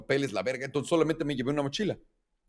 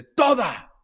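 demanding order; 8 semitones higher, 4 dB louder than usual. Retold or imitated. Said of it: "Y me dice".

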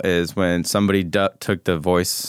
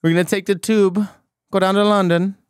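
bad Cockney accent; 10 semitones higher than usual. Asked for a Cockney accent, a hammed-up bad Cockney. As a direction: do a cartoon Cockney tour guide. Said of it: "Cockney accent".

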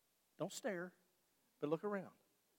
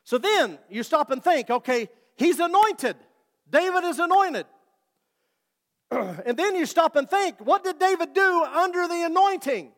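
louder, higher preaching build; full-force preaching; 9 semitones higher, 8 dB louder than usual. Acted. Preacher urging the church that God looks at the heart, fervent. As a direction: drive the point home to the congregation.

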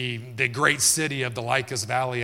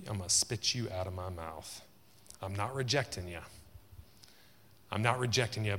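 quiet tender turn; 4 semitones lower, 7 dB quieter than usual. Acted. Gentle turn after loud rebuke.

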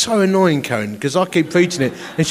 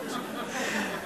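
laugh; 6 semitones higher, 16 dB quieter than usual. Real, not acted. Amused at own story.

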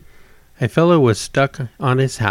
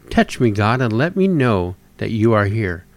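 normal talking voice

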